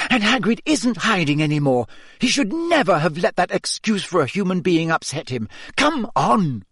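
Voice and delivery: high, determined urging; rally the friends angrily; fierce. A narrator's reading. Hermione in a rage.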